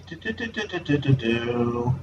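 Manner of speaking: usual speaking voice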